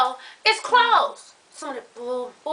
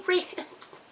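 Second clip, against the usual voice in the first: breathy whisper of longing for ribs; whispered craving; 11 dB quieter than usual. Acted. Friend whispers to the audience about the ribs she craved. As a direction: whisper it like a secret desire.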